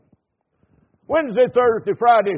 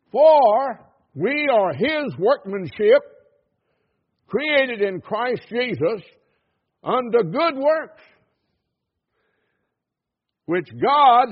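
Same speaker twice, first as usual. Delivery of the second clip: slow, louder, weighty reading of the verse; solemn scripture reading; 5 semitones higher, 5 dB louder, slower than usual. Acted. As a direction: let every word land. Reads scripture slowly, loud.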